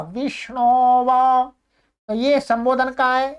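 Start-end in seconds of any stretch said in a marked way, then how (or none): 0.0-1.5 विष्णोवा stretched slowly as one word; silent अ. Shown as a demonstration. Teacher joining a second example, silent अ. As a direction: draw out the ो in विष्णोवा, no अ after it. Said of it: "अ है वो silent हो जाता है".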